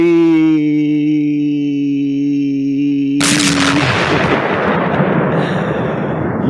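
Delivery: a long, loud burst of real laughter. Real, not acted. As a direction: laugh hard at your own joke. Laughs at own joke.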